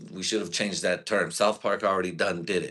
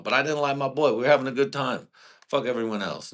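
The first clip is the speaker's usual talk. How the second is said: higher and louder, fired up; pumped storytelling; excited; 6 semitones higher, 4 dB louder than usual. Real, not acted. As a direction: burst out with excitement about the good time. Excited about show with boy.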